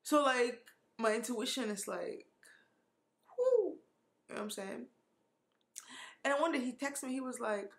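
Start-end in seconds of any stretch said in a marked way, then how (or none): 3.4-3.8 high, loud whoop of misgiving; high "whoo". Real, not acted. Gut feeling voiced as a sound.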